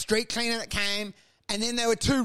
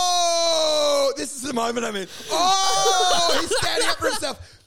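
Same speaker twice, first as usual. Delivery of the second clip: high, loud sneering mockery, quickening at the end; mock him like a jeering bully; 9 semitones higher, 4 dB louder than usual. Bullies jeer at the kid standing up, mocking voice.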